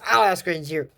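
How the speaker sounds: ordinary delivery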